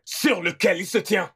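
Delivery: normal talking voice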